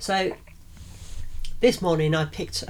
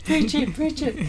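mock preacher echo; 10 semitones higher than usual. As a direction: egg yourself on in mock revival style. Echoing the cheer mockingly.

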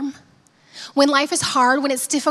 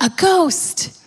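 mild mock cry of fright, pitched up; a touch of alarm, higher; cry of terror; 4 semitones higher than usual. A narrator's reading. Disciples seeing a figure on the water, terrified cry, understated. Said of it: "they cried out in terror".

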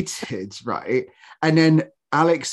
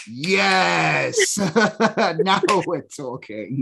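long drawn-out, relishing yes; gleeful triumph; 3 semitones higher, much slower than usual. Retold or imitated. Fan seeing Elizabeth Hurley in the titles, gleeful triumph. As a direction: savour it slowly, breaking into laughter. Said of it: "I was like".

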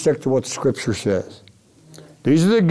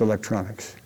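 quieter, lower echoed repeat; 7 semitones lower, 9 dB quieter than usual. Retold or imitated. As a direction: drop the voice and repeat the word. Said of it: "he would end every sentence by repeating himself".

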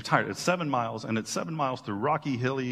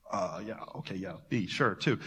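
softer, lower, casual shrug of a reply; a hint of a nonchalant Peter; unimpressed Peter; 6 semitones lower, 4 dB quieter than usual. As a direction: shrug it off like old news.

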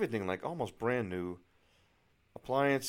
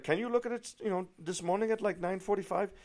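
German realtor; 10 semitones higher than usual. Retold or imitated. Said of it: "tell by the accent she's obviously from Germany".

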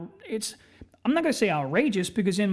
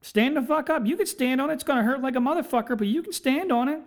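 laughing doctor; 6 semitones higher than usual. Retold or imitated. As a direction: play the amused doctor telling him to stand up, pitched up. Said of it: "The doctor starts laughing his dick off and goes".

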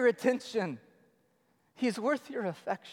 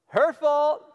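petulant high loud blame, higher and louder; whiny Adam; 6 semitones higher, 8 dB louder than usual. Retold or imitated. Preacher mocking Adam blaming his wife. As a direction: blurt it like a sulky kid caught out. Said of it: "He's like".

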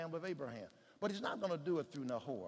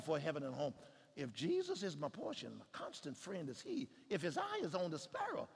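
subdued pulpit poetry; hushed hymn recitation; 4 dB quieter than usual. Acted. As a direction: recite it inwardly, quietly. Recites hymn words quietly.

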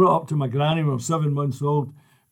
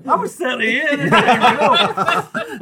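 higher and louder, laughing through it; 6 semitones higher, 6 dB louder, slower than usual. Real, not acted. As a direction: laugh as you finish the story. Laughing at dad story.

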